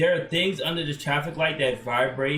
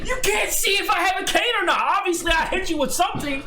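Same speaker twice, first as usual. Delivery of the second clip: mock argument shout; 14 semitones higher, faster than usual. Streamer keeps acting the blind men's quarrel, mock yelling. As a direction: rant it quickly like the angry blind man.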